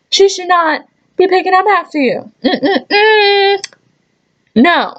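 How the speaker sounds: high, scolding disapproval, shaking the head with mm-mm-mm; indignant scolding. Acted. Waitress scolding a rude regular, high and indignant. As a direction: scold him like a disgusted aunt.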